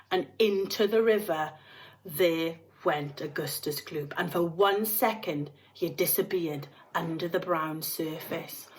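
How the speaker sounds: usual speaking voice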